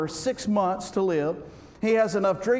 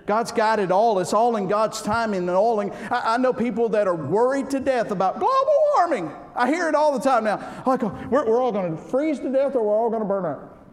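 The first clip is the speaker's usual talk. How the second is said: loud, higher, driving preaching; full-force preaching; 3 semitones higher, 4 dB louder than usual. Real, not acted. Preacher loudly declaring God's control to the congregation. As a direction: hammer it home to the congregation.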